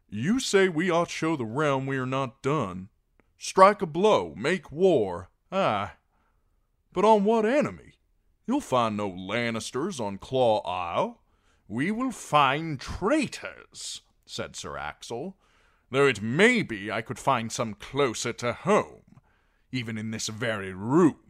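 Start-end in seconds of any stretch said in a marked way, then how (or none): 0.1-11.2 loud, high, pressing argument; blunt, forceful rebuttal; Davos, challenging. A narrator's reading. 11.7-14.0 loud, slow, high accusation; heavy, emphatic retort; Sir Axel, accusing. A narrator's reading. 15.9-21.2 raised, pointed accusation; loud, insinuating knight; Sir Axel, jibing. A narrator's reading.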